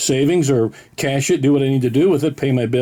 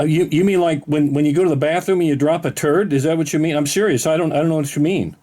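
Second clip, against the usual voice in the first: ordinary talk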